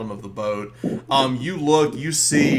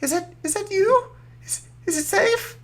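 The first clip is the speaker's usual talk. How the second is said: much higher, timid little voice. Acted. GM voicing the hiding little one, tiny timid voice. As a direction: squeak it out like a frightened small creature. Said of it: "you hear this little voice go".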